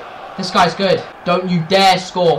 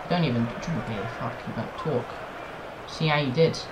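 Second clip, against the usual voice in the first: ordinary delivery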